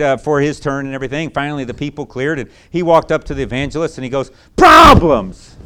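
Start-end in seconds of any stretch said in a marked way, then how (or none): 4.5-5.6 sudden loud high shout of one word; blurted shout. Retold or imitated. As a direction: blurt it out loud like a bitter teen. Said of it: "Just like that".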